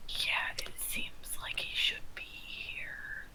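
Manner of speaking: slow, barely audible whisper. Acted. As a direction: whisper it slowly while in hiding. In character, hiding from the knight.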